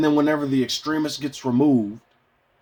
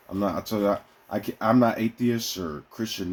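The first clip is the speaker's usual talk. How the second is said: ordinary talk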